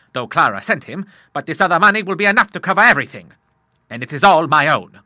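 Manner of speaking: put-on a touch of a jolly old sailor cheering up his son; father reassures his troubled son, lightly gruff; bluff old Admiral. A narrator's reading. Admiral's held voice.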